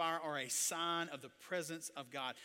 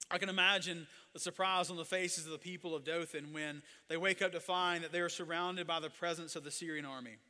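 ordinary delivery

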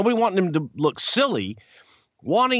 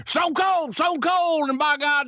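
much higher excited Southern announcer call; imitating J R's commentary; 13 semitones higher than usual. Imitating J R calling a match for the listener, excited higher voice. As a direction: mimic J R calling Stone Cold, all fired up. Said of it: "he's talking like this".

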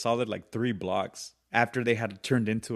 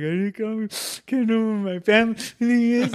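put-on crying child; 11 semitones higher, 4 dB louder than usual. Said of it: "And I was like".

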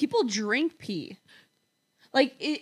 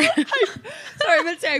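laughing; 8 semitones higher, 4 dB louder than usual. Real, not acted. Laughing at her own slip.

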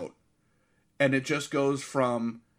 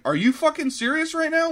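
much higher, disbelieving mock outburst; incredulous; 13 semitones higher, 4 dB louder than usual. Host imitates Adam Scott in attic scene, high incredulous. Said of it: "He's like".

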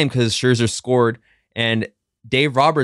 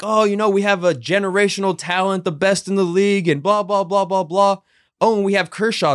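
raised-pitch radio promo hype; mock commercial voice; 7 semitones higher than usual. Host mimics the Dodger station's commercials, in mock promo hype. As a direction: parody a team's radio ad, pitched up. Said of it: "they run Dodger commercials".

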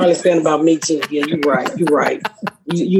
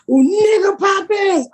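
high, drawn-out heckler's yell; fans yelling out; 11 semitones higher, much slower than usual. Retold or imitated. Audience member at her show, yelling out a line. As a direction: holler the show's line slowly, pitched way up. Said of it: "started to yell out".

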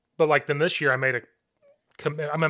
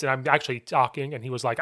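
ordinary talk, nothing marked

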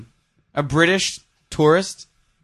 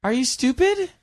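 mocking sneer; 10 semitones higher, 4 dB louder than usual. Mocking a put-down rejection.

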